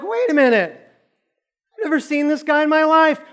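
high, loud indignant protest; mock freak-out. Jenny denying she knows the man, mock outraged protest. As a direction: act out outraged denial, pitch way up. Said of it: "Now she's freaking out".

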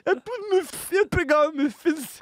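very high, squealed mock crying; imitated sobbing outburst, far above normal pitch; mock wailing. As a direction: bawl the repeated confession, hammed-up. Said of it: "begynner jeg å gråte enda mer".

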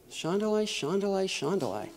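very soft acted prayer syllables; private tongues. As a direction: murmur them softly to yourself. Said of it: "I can sit in my seat".

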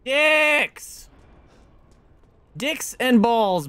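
high drawn-out slow groan-yell; frustrated yell. Real, not acted. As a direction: drag out the frustration. Game forces climb back up.

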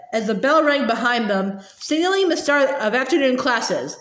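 usual speaking voice

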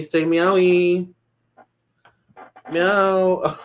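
high, slow coaxing pet voice; baby talk to pet. Acted. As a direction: coo at the cat like a baby. Coaxing the cat for viewers.

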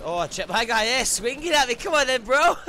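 high, loud frantic retelling; hyped after a fight; excited yell. Real, not acted. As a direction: shout it out through the adrenaline.

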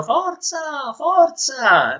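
high-pitched cheer; cheering shout. Acted. Passengers and tourists cheer on the swimmer, calling high. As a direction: urge the swimmer on in a high call. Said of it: "passeggeri e turisti gridavano".

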